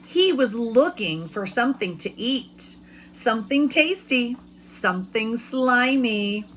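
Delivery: slow, lively storybook reading; reading to children. A narrator's reading. Teacher reading a picture book to her class, lively storytelling.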